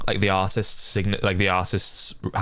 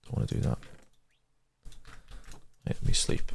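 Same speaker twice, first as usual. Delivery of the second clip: whispered muttering; 7 dB quieter than usual. Real, not acted. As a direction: whisper under breath. Muttering at game.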